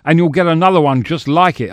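angry shout. Acted. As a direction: shout a warning at a groping co-star. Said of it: "I shouted".

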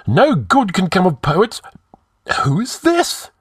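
very high, agitated voice; fretful high-pitched gibber. A narrator's reading. High Counselor nervously finding a dead body, gibbering. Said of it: "gibbered Publius".

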